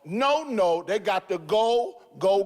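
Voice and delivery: high, fervent preacher cadence; preaching rebuke. Real, not acted. Pastor condemning officials who failed children. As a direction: preach it with rising fire.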